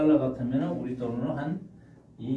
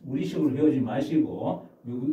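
normal talking voice

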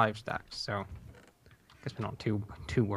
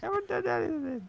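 mock innocent. Innocent high voice.

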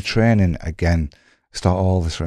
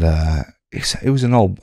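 ordinary delivery

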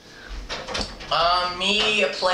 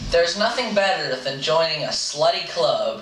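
reading aloud. Acted. Reading the finished story.

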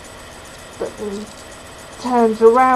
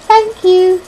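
put-on high, louder small-boy voice; little boy's voice; 9 semitones higher, 7 dB louder than usual. A narrator's reading. Boy thanks a girl for a gummy worm, little boy's voice. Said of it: "But then he says".

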